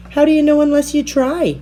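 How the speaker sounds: eager encouragement. A narrator's reading. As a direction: coax her on brightly. Bear Cub encouraging Nell.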